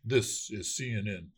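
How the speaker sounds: slow, low network announcer line; measured announcer delivery, dropped in pitch; news ident demo. Shown as a demonstration. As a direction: intone it like a station ident. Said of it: "Try this".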